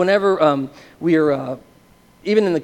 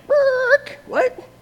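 very high screech, then squeaked question; imitated brake screech. Brakes go on in his brain, high screech.

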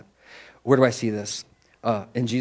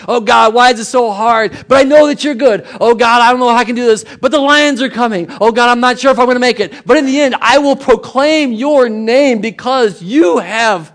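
high, loud, fervent pleading; impassioned psalm cry; 9 semitones higher, 10 dB louder than usual. Acted. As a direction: cry out to God at full voice. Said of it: "it goes back and forth".

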